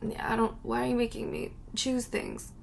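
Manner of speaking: ordinary talk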